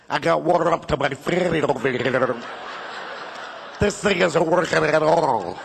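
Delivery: gargled complaint from a flooded caller; wobbly, waterlogged prank voice; bubbling underwater voice. Acted. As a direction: burble every word as if water is rising. Flooded customer calling the plumber.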